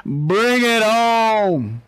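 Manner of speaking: very drawn-out, high holler; triumphant challenge. Real, not acted. Player gloating after the knockout, drawn-out challenge. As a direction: stretch each word out in triumph.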